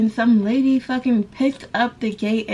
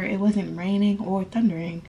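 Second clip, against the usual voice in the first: ordinary talk, nothing marked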